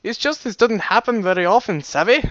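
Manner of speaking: rough mock Irish accent; Irish-ish accent. Shown as a demonstration. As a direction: attempt a muddled, vaguely Irish accent. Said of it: "Irish-ish".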